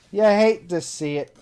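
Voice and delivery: milk the fake sorrow; hammed-up lament over lost beer; mock dramatic. Shown as a demonstration.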